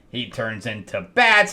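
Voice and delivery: ordinary talk, nothing marked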